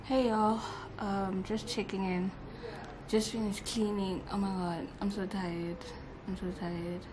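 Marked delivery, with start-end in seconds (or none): none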